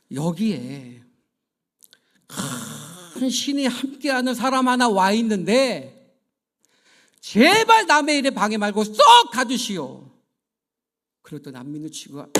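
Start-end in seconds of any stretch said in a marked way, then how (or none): 0.1-10.1 loud, raised voice ordering from on high; spirit's loud command. Retold or imitated. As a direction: boom it out like a spirit speaking through someone. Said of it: "또 한마디를 하는 거예요".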